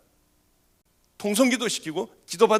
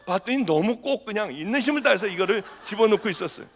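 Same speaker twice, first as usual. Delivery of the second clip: ordinary talk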